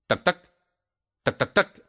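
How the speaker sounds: high tac tac pecks; woodpecker tapping. Acted. Woodpecker breaking the axe, pecking. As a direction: peck it out.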